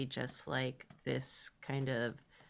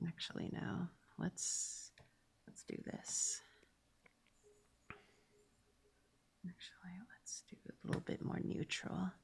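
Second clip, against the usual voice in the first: whispering to self; 10 dB quieter than usual. Real, not acted. Muttering to herself while drawing.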